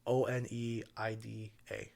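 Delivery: normal talking voice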